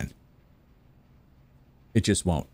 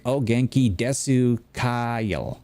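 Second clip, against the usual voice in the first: slow mock-Southern beginner Japanese; southern accent; 2 semitones higher, much slower than usual. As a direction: stretch every vowel in a Southern accent. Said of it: "in a southern accent".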